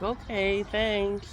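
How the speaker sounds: high, soft quoted reply; soft high-pitched mimicked thanks; imitating coworker. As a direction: mimic her, high and quiet. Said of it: "She's like".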